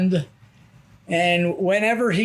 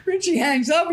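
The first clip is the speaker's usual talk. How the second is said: ordinary talk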